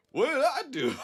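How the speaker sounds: very high, loud mock protest; cracking up. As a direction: wail it in mock innocence, bursting into laughter. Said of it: "starts cracking up laughing".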